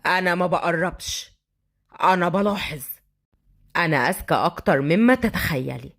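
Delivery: low, controlled man's voice; stern thug. Acted. Thug answering the girl's warning, low stern voice. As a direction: drop the pitch, stay hard. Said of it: "وهو قاسي الملامح".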